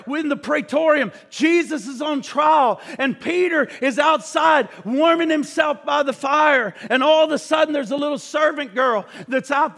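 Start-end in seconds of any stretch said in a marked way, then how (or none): none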